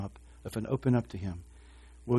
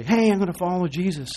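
eager vow; 6 semitones higher, 6 dB louder than usual. Pretend. Mock eager believer quote.

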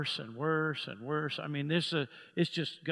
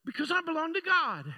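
higher, fervent declaration led line by line; leading a declaration; 7 semitones higher, 4 dB louder than usual. Acted. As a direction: call it out for the church to echo. Leads congregation in declaration.

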